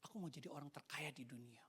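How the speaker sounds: hushed, pensive quoted reply. Retold or imitated. Child answers what they'll be when grown, hushed and pensive. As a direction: murmur it softly, as if lost in thought. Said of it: "Tiba-tiba merenung".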